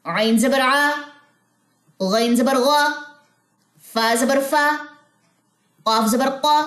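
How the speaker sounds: careful letter-by-letter recitation; teacher spelling out letters for the class; spelling drill. Shown as a demonstration.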